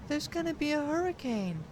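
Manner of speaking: put-on eerie high girl voice, no emotion; an eerie child's flat prophecy; emotionless girl. A narrator's reading. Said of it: "almost emotionlessly".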